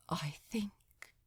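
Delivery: choked whisper, voice cracking; barely holding back tears; cracked, thick voice. A narrator's reading. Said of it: "in a voice that was cracked and thick".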